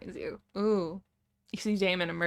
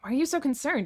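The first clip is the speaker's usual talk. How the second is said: high, louder retort; biting back; 8 semitones higher, 5 dB louder than usual. A narrator's reading. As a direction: throw the question back at him. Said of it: "you bite back".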